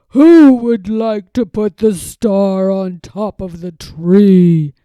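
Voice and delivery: loud, slow, airy Santa voice; jolly Santa. Acted. Santa asking the children who will place the star, loud and slow. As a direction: invite the kids like Santa.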